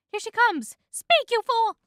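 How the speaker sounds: high, loud, urgent panic; panicked self-urging. A narrator's reading. Bee sees the woman coming, panicking loudly. Bee urges himself to speak.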